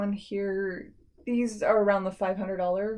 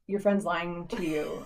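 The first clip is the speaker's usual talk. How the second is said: deadpan slow; 3 semitones lower, 4 dB quieter, slower than usual. Retold or imitated. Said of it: "And I'm like".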